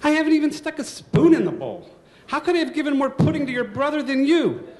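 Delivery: high, loud outraged mother; indignant mom. Retold or imitated. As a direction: snap back like an offended mother. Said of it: "my mother said".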